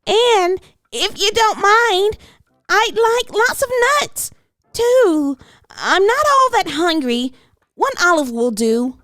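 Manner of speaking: put-on sing-song child ordering lunch; eager little girl. A narrator's reading. Hannah voice continues.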